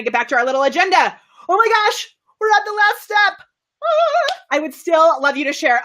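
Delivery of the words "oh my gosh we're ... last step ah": high-pitched gushing excitement; excited squeal. Real, not acted. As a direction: squeal with glee at the big moment. Mock alarm at reaching last step.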